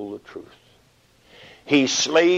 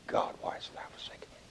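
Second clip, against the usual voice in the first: whisper; 17 dB quieter, much faster than usual. Acted. Dying Jesus.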